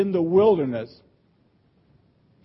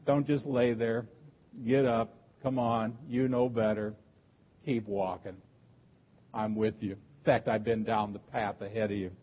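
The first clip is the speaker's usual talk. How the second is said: low, hushed voice, well under preaching level; subtle, gentle urging; 6 semitones lower, 7 dB quieter than usual. Retold or imitated. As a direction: coax softly, as God quietly urging someone on. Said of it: "God's subtle way of saying".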